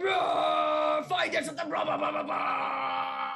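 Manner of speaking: loud, high roaring shout of vague orders; hammed-up roar of a floating head; mock monster roar. A glowing disembodied head roars at the man on the toilet. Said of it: "he's just like".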